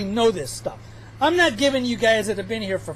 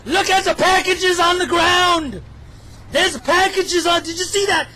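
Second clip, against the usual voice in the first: urgent call-out; 7 semitones higher, 3 dB louder than usual. Shown as a demonstration. Telling viewers to notice the packages, emphatic call-out. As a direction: point it out loudly to the viewers. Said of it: "something I wish people would notice".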